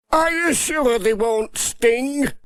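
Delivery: high, slow, worried asking; nervous farmer. Acted. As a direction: sound uneasy about the bees. Peter voiced nervously.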